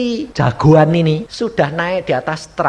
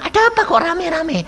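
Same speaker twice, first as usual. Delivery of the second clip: high-pitched alarmed homecomer; acted mistress finding a commotion; startled question. Mother returns to find a crowd.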